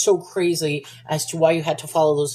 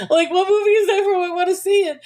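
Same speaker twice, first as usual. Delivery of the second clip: high, louder excited burst; excited eagerness; 11 semitones higher, 4 dB louder, faster than usual. Real, not acted. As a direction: blurt it out, dying to know. Eagerly asking which movie it is.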